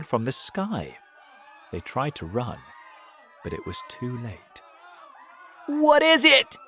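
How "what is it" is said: very high, loud shout; alarmed shout. A narrator's reading. Crowd sees a giant ball falling, alarmed shout. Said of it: "they shouted".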